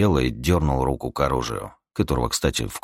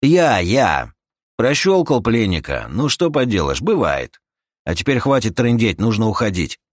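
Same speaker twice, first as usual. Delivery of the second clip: raised-voice mocking retort, easing off; dismissive companion; 6 semitones higher, 4 dB louder than usual. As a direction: taunt him, then urge moving on. (насмешливая отповедь, чуть повышенным тоном)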